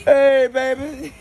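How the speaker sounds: high, drawn-out sweet hello; flirty greeting. Acted. Greets his woman, drawn out.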